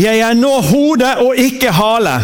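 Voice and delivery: preacher leading a spoken creed; leading a confession. Acted. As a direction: declare it for them to echo. Leads confession to repeat.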